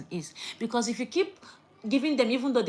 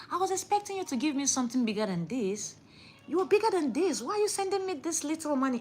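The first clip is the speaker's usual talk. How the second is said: higher, sniffy complaint about the small gift; mock ungrateful receiver; 7 semitones higher than usual. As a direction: play a spoiled receiver turning up their nose.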